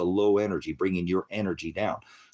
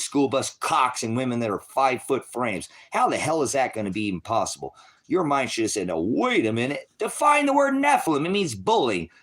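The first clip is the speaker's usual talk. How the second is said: loud, hard-driving sermon rant, often higher; fiery preaching; 5 semitones higher, 4 dB louder than usual. Acted. Preacher berating doubters in a loud fiery rant. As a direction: preach it at full force, scolding the crowd.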